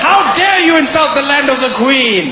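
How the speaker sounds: high, loud outraged demand; loud high outrage; indignant shout. Acted. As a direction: bluster with offended pride.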